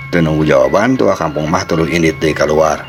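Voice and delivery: ordinary delivery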